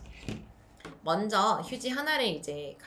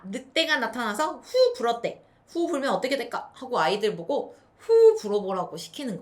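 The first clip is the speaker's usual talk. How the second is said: storytelling excitement; 3 semitones higher, 7 dB louder than usual. Shown as a demonstration. 늑대 이야기 연기.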